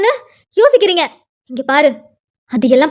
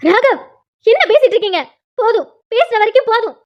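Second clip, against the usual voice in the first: much higher, stopping him short; urgent pleading; 6 semitones higher than usual. Acted. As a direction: cut in high and urgent. Wife stops husband's tirade.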